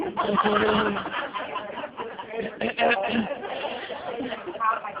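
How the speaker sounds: bursts into a loud real laugh. Real, not acted. Laughing at memory of Jerry Springer.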